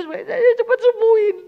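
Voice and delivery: high, loud mock whine of a spoiled child; childish whine. Preacher mocking a wife who whines over a scratch, high loud whine.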